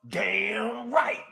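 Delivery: mock yell, drawn out and rising; retold scream. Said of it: "And he screamed out".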